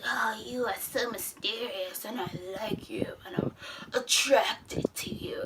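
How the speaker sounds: soft, whispered flirting; mock breathy flirt. Bruce whispering his attraction to the villain, soft. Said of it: "Bruce is like".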